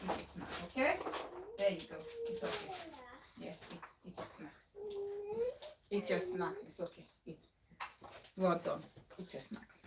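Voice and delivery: hushed, half-whispered comfort; soft soothing. Real, not acted. Mother softly hushing her upset toddler with snacks.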